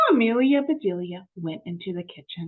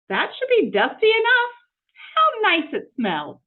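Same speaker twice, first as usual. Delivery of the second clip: high, louder and pleased; higher, pleased maid voice; satisfied Amelia Bedelia; 11 semitones higher, 5 dB louder than usual. Acted. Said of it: "It was powder".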